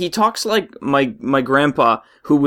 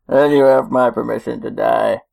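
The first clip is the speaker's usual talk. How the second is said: put-on villain voice, lower than the chatter; film villain impression; 3 semitones lower than usual. Finishing the film quote for co-hosts, villain voice. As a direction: deliver a hammed-up villain's verdict.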